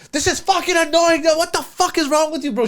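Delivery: imitating the bride yelling at her groom, pitched far up; mock angry bride yell. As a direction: squeal it like a bride losing her temper. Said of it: "She's yelling at the top of her lungs".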